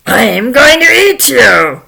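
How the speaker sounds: menacing troll bellow; horrible troll. Acted. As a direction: roar it like a hungry monster. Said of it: "there was this horrible troll".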